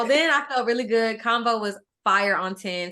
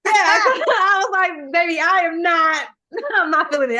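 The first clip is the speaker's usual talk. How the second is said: laughing; 5 semitones higher, 4 dB louder than usual. Retold or imitated. Laughing while quoting herself.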